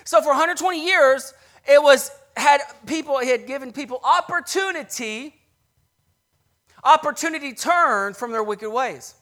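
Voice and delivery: loud, rising preacher's cry; full-force sermon delivery; preaching at full force. Acted. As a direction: preach it out to the congregation.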